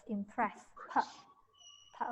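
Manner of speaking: teacher drills the p in "impress"; pronunciation drill. Shown as a demonstration. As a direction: isolate the p after "impress". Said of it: "we pronounce it impress".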